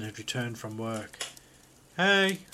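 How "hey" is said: high, loud greeting call. A narrator's reading. Husband home from work to his sleeping wife, calling out. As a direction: call across the room. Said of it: "he called".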